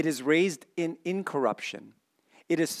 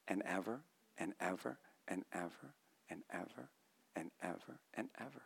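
much lower, much softer, trailing away; fading repetition; 6 semitones lower, 20 dB quieter than usual. Real, not acted. Preacher closing on eternal access, fading hush. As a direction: let the evers drift into a hush.

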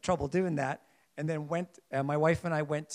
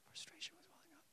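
whisper; 13 semitones higher, 25 dB quieter, faster than usual. Shown as a demonstration. Preacher whispering his rising frustration to the congregation. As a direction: whisper it, no voice at all.

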